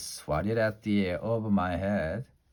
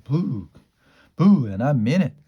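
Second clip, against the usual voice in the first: mock boo; 2 semitones higher, 7 dB louder than usual. A narrator's reading. Jumps at girls to scare.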